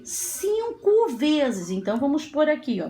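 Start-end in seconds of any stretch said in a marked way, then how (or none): 0.0-1.7 "Cinco vezes" slow, higher and drawn out; emphatic count. Shown as a demonstration. Teacher giving pupils the answer, "Cinco vezes" slow and emphatic. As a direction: stretch "Cinco" high, then land on "vezes".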